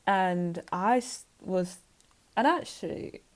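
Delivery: usual speaking voice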